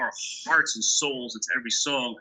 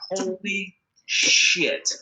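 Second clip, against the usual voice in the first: slow, drawn-out awestruck holy shit; stunned disbelief; 5 semitones higher, much slower than usual. Retold or imitated. A singer stunned when [name] phones, slow amazement. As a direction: let it fall out of you in shock. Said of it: "I'm like".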